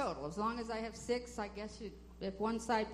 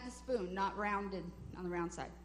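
ordinary talk, nothing marked

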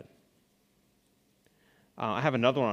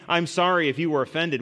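fake apology; 5 semitones higher, 6 dB louder than usual. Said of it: "what he's saying".

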